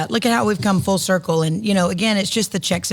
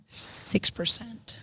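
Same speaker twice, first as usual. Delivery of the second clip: slow, whispered cheeky ask; sly whisper; 4 semitones higher, 8 dB quieter, much slower than usual. Pretend. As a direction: whisper the price, teasing and slow. Cheeky price ask after the buildup.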